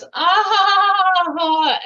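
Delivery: high, loud, slow laugh; demo laugh crest. Shown as a demonstration. Speaker shows the laugh cresting and fading, laughing loudly. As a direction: laugh big at the top, then let it ease off. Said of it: "the crest of the laugh goes down".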